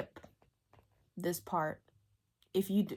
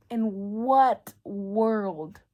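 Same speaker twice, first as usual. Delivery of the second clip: ordinary talk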